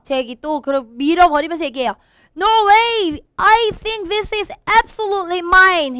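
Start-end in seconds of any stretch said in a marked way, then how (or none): 2.3-6.0 high, loud, insistent shout; indignant, high-pitched yell; shouted protest. Acted. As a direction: shout it out, claiming it as yours. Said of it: "he shouted".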